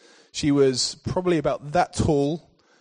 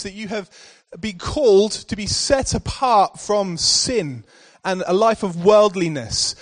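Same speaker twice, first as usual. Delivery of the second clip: raised, higher preaching voice; 4 semitones higher than usual. Acted. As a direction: drive the point home to the congregation. Preaching application.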